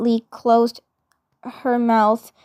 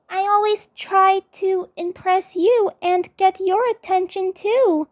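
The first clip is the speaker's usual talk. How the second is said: put-on higher bashful girl voice; shy girl voice; 7 semitones higher than usual. A narrator's reading. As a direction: say it shyly.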